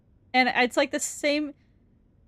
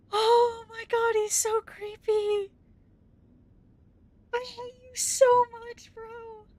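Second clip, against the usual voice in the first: much higher, slow, whimpering; scared whine; 10 semitones higher than usual. Real, not acted. Terrified of the creepy monster, a high whimper. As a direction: whimper in fear.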